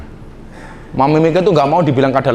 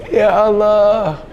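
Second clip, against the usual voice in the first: drawn-out Ya Allah through laughter; laughing; 4 dB quieter, much slower than usual. Real, not acted. Preacher cracking up at his own tattoo joke, laughing.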